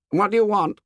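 high sharp challenge; challenging demand. A narrator's reading. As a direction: confront him from behind. Jack challenges Ralph.

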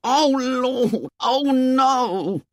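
put-on high, horrified boy's cry; drawn-out high exclamation of dread; panicked wail. Piggy panics at Ralph quitting.

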